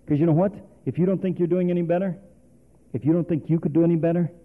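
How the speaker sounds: usual speaking voice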